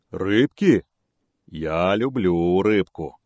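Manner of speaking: slow, greedy bear savouring the word; bear licking lips. A narrator's reading. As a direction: relish it like a hungry bear. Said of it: "облизнулся медведь".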